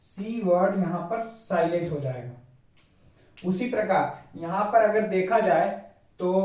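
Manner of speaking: normal talking voice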